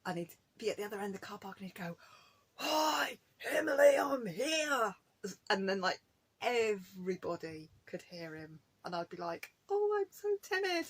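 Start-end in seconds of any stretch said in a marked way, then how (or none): 2.5-4.9 high, loud, drawn-out holler across a car park; imitated Scottish shout. Loud husband hailing her across the car park, mock Scottish shout. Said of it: "when he shouts, he is so Scottish". 9.7-10.9 very high, tiny timid voice; mock timid. Her old shy self at being shouted for, mock timid. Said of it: "I used to be really timid".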